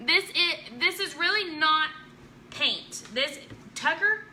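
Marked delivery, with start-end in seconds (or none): none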